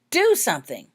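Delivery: loud, urgent demand; frustrated plea. A narrator's reading. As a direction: insist he acts now. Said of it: "cried Jenny in frustration".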